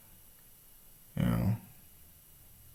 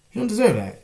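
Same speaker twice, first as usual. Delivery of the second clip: "deserve" hit much higher and louder the second time; emphatic repeat; 11 semitones higher, 5 dB louder than usual. Retold or imitated. Defying the cop, repeat "deserve" higher and louder. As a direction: punch "deserve" up high with force.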